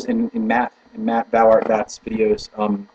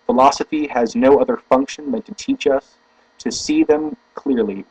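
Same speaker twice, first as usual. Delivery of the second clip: deliberate, emphatic reading aloud; formal book reading; 4 semitones higher than usual. Acted. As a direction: read it out with weighty stresses.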